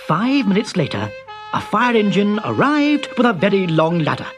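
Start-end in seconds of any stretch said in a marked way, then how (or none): none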